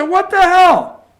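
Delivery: hammed-up Trump yelling get out; Donald Trump, angry. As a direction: blow up like an outraged Trump, shouting high. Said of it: "Trump said".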